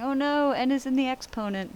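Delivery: high, loud worried student; mock student panic. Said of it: "But you're saying".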